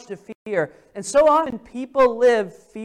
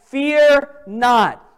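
higher, louder Don't be afraid; proclaiming; 3 semitones higher, 6 dB louder, much slower than usual. Acted. Preacher to congregation, proclaiming don't be afraid. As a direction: call it out with force.

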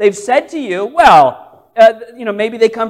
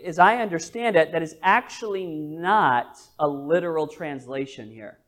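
ordinary delivery

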